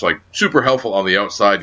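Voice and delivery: ordinary delivery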